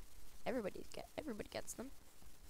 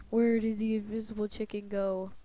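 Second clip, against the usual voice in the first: loud playful call; 8 dB louder than usual. Pretend. Invisible chicken joke.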